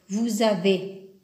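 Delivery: "Vous avez" slowly, linking z: vouz-avez; slow liaison. Shown as a demonstration. Teacher modelling "Vous avez" slowly with the liaison. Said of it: "on doit faire la liaison".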